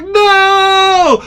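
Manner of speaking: long, high-pitched wail of dismay; horrified cry. Retold or imitated. Sick angler seeing the chicken livers, horrified cry. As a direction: draw it out high in mock horror.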